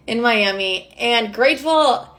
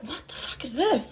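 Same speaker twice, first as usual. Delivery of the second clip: much softer, stunned aside; hushed disbelief; 2 semitones higher, 10 dB quieter than usual. Retold or imitated. Retelling her shock at the hotel room, hushed disbelief. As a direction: say it under your voice, taken aback. Stunned reaction to strange men.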